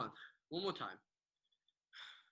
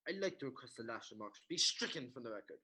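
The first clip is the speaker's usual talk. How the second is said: ordinary talk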